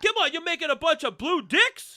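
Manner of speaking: high, loud husband voice; mock husband. Husband complaining to his wife, high and loud.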